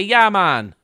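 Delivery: a loud, high Jamaican "Yeah, man"; Jamaican accent. Retold or imitated. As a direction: throw out a cheerful Jamaican greeting.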